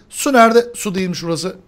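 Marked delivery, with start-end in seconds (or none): 0.1-0.7 higher, louder su nerede; urgent yell. Real, not acted. Host frantically searching for water in the level. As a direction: call out urgently.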